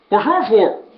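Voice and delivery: lightly voiced puzzled driver; a hint of Vern, puzzled; lightly voiced Vern asking about the cash; Vern's way of talking. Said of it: "That's how Vern talked".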